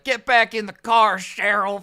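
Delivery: loud call across a parking lot; husband yelling to wife. Retold or imitated. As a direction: shout it from the other side of the truck. Husband calling from far side.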